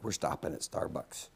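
hushed, breathy mom aside; mom's voice. Retold or imitated. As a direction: whisper it like mom deciding for everyone. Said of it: "Mom's like".

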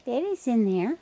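high, sing-song coo to the animals; tender high voice for a raccoon; sweet pet talk. Real, not acted. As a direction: coo at them like pets. Baby talk to feeding raccoons.

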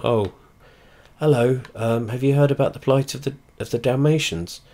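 mock-polite fundraiser at the door; hammed-up chirpy canvasser; doorstep charity collector. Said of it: "I did charity collecting around Paulsgrove".

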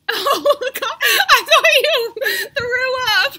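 high, laughing loud and slow. Real, not acted. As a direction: laugh out the words. Laughing at sister.

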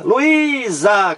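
Luísa with a drawn-out, high Í; lu-ÍÍÍ-sa, the Í of Luísa held; stressed syllable demo. Shown as a demonstration. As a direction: stretch the strong second syllable of Luísa. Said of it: "Luísa também aqui é a mesma coisa".

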